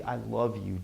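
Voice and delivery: very quiet and low; hushed, intimate murmur; soft "I love you". Shown as a demonstration. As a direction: say it softly, sinking away. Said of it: "Why do we say".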